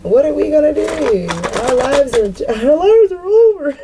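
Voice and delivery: high, rising mock wail of doom; hammed-up cry that life is over; mock despair. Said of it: "I'm hearing this shit in my head".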